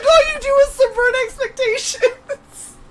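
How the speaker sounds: high, loud, laughing disbelief; excited shout. Retold or imitated. Retelling her reaction to the trucker, laughing excited shout. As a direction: shriek it out like you just struck gold.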